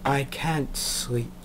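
put-on slow, quiet American girl's voice; a sleepy American girl, drawn out and soft; quiet, slow American accent, barely above a murmur. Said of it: "now she's gone American".

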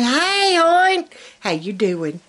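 bright, high sing-song hey hon; friendly Southern greeting. Shown as a demonstration. Showing viewers the true Southern way to greet, sweetly. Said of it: "just say".

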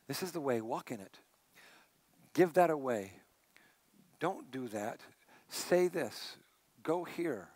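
soft, low, gentle promptings; quiet inner voice. Retold or imitated. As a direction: say it like a still small voice. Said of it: "this clear, quiet voice".

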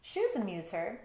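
usual speaking voice

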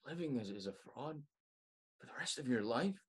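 low, soft, imploring; softly begging a friend to stop pretending; quiet plea. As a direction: let it drop to a hushed, sad appeal. Final plea to drop the mask.

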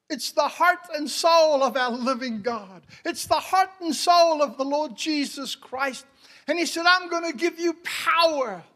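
loud, high pulpit proclamation; impassioned, ringing shout; full-force preaching. Real, not acted. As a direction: declare it to the whole church at full force.

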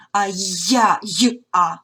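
ordinary delivery

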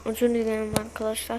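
talking while chewing gum. Real, not acted. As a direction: speak around a piece of gum. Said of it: "sakız çiğniyorum".

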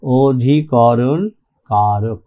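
drawn-out, low syllable by syllable; slow dictation. Real, not acted. Teacher dictates term while writing. (ধীরে, নিচু গলায় টেনে টেনে বলা)

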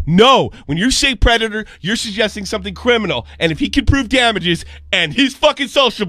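loud, high and fired up, warning a fellow creator; heated rant. Real, not acted. Heated rant at another YouTuber.

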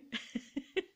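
a light airy giggle; breathy laugh. Real, not acted. A breathy giggle at herself. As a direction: laugh softly under the breath. Laughing at her own joke.

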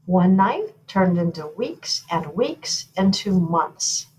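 usual speaking voice

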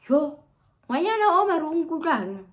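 high, loud, slow imagined yell; imagined friend's shout. Retold or imitated. Imagined friend yelling in shock about the room, loudly. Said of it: "I'm sure she's like".

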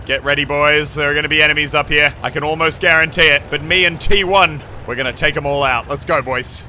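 ordinary talk, nothing marked

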